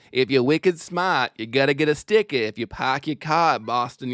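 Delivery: practised Boston accent, dropping the R's. Shown as a demonstration. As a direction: recite it like a Boston accent drill. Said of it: "How to do a Boston accent".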